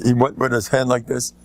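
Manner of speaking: normal talking voice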